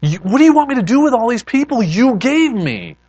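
loud, high-pitched exasperated outburst; frustrated cry. Acted. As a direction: cry out to God, mad and irritated. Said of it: "he cries out and says".

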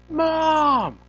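high, drawn-out mock cry for help; child calling Mom. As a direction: wail for mother like a chased kid. Retold child fleeing to his mother.

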